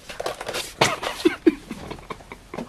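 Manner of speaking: high breathy airless giggle; silent giggle. Real, not acted. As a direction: giggle with no voice behind it. Laughs at his own prank.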